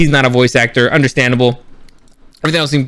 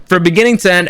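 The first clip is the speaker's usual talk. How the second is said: normal talking voice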